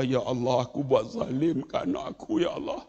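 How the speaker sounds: low, hushed remorse, retold; softly imitating a father's tearful plea; remorseful father praying. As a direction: murmur a regretful prayer under the breath. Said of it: "Terus dia menangis".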